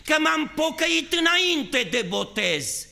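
high, loud pleading cry to God; fervent shouted prayer. Acted. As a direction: cry out to the Lord with all your strength. Predică în forță. (rugăciune strigată, tare și sus)

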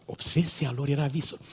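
quiet repeat. Shown as a demonstration. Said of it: "repeated after loud take".

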